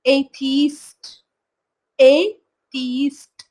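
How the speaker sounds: एथीस्ट stretched very slowly; slow pronunciation drill. Shown as a demonstration. Teacher models the word for students, very slow. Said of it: "एट word हो गया".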